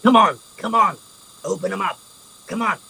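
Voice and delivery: a touch of an eager gnoll whispering come on; urging gnoll. Acted. Gnoll urging the rogue to open the chains, eager. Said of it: "you hear the gnoll".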